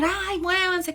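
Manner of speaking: high-pitched bossy mock yell; imitated yelling. Comedian's character ordering family to pose, mock shouting. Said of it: "empezó a gritar".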